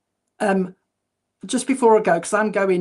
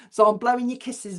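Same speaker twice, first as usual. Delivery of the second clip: normal talking voice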